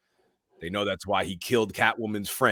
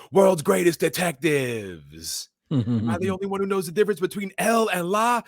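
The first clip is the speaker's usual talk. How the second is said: higher, sneering jabs at the detectives; mocking Penguin; 4 semitones higher, 4 dB louder than usual. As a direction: scoff at them like fools. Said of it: "Penguin's like".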